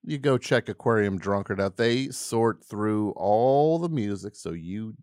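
usual speaking voice

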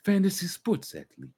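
high-pitched mobster parody; Tony Soprano. Acted. Host riffing on varsity fantasy athletes, high mock mobster voice. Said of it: "that was a Tony Soprano".

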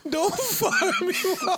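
high laughing squeal. Real, not acted. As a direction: laugh through the words. Laughing repeat of joke.

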